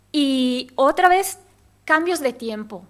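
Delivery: ordinary talk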